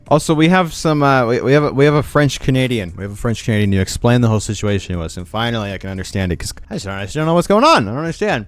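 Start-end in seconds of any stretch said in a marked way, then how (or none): none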